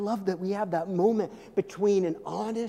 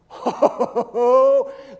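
delighted laugh; 5 semitones higher, 10 dB louder than usual. Acted. Jesus voice laughing.

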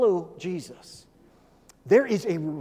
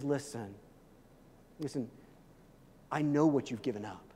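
tender reassurance; 7 semitones lower, 6 dB quieter than usual. Acted. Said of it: "where he says".

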